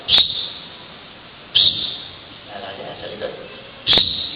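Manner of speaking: sharp psst hiss from behind. Retold or imitated. Former lover calling him from behind with a hiss. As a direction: call someone secretly with a psst.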